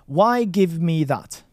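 "Why give me that?" spoken slowly, word by word; slow model sentence. Shown as a demonstration. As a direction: say it slowly for the learner. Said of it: "First we go".

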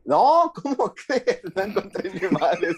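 high, slow laughing protest; giggling fake innocence; laughing denial. Real, not acted. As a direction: laugh it off, caught out and amused. Caught without notes, laughing.

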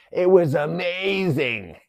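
high, loud, long-drawn hammed-up gushing; mock gushing. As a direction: stretch it out in exaggerated raving. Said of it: "he's like".

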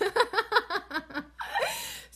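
loud high laugh breaking out; high burst of laughter. Real, not acted. Laughing at her own joke to viewers, high and loud. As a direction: crack up at your own joke.

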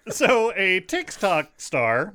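put-on slow, loud old-timey announcer; 1950s narrator. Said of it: "old-timey, like, voice in my head".

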